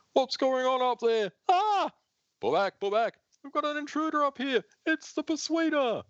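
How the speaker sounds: high panicked voice; panicked guard. Acted. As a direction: scream into the radio.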